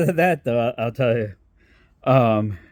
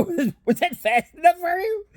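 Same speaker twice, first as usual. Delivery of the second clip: laughing quote; 11 semitones higher than usual. Retold or imitated. Mocking friend after speeding.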